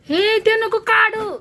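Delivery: quick high-pitched "don't take that off"; sharp high warning. Real, not acted. Mother warns her little boy off, high and loud. Mother stops child grabbing something.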